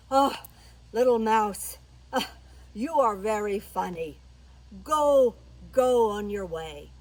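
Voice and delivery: loud, condescending lion voice; big lion recovering from laughter; amused lion. Acted. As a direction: dismiss the little one with booming amusement. Amused lion sends mouse off.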